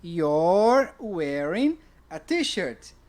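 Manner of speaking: "You're wearing a t-shirt." said slowly and clearly; slow, clear example answer; slow model answer. Shown as a demonstration. Teacher to class, slowly modelling the answer. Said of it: "Aí você me responde".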